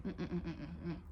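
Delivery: soft, rapid string of "mm-mm"; disapproving mm-mm. Real, not acted. Coach refuting "I'm done" with viewers, soft disapproving hum. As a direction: quietly hum a repeated no. Rejects the claim of being done.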